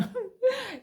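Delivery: high burst of laughter; amused laugh. Real, not acted. Joking about pronunciation, bright laugh. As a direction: laugh at her own joke.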